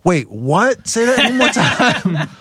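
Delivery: high, slow incredulous; disbelief. Retold or imitated. Band hearing the gorilla warning, incredulous. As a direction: play your stunned younger self.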